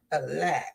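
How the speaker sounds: A LOT set apart, low and falling; emphatic A LOT. Real, not acted. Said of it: "When I say a lot".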